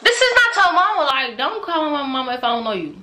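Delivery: high, loud outraged correction. Real, not acted. Vlogger outraged at the stranger calling her mother mama. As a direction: snap back, offended he said mama.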